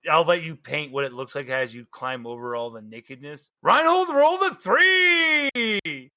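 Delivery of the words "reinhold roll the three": high, slow, stretched call-out; drawn-out announcement. Real, not acted. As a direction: sing out the bad roll. Game master announces a bad roll.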